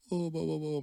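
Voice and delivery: high, babbling mock breakdown; mock crying. Kid breaking down at the front door, mock blubbering. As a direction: imitate an emotional kid blubbering wordlessly. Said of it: "break down crying".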